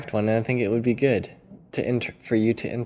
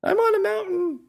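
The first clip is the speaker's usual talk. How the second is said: high calling voice; calling from a mountaintop. Pretend. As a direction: shout out from a summit. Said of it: "You could do, like".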